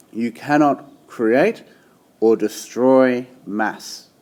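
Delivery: slow, deliberate, each word weighed; said really clearly. Shown as a demonstration. Teacher stressing the key law to students, slow and deliberate. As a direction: spell out the law slowly for the class. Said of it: "So I wanna say that really clearly".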